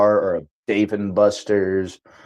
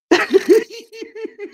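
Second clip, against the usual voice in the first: laughing. Real, not acted. Laughing at the glitch.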